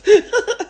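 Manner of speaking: very high loud laugh; shrieking laugh; high burst of laughter. Real, not acted. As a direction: crack up laughing. Laughing at own story.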